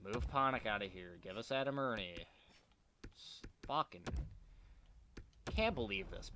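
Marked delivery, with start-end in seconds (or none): none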